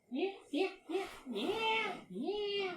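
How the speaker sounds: high squeaky string of yeahs. Acted. Mocking the friend with squeaky yeahs.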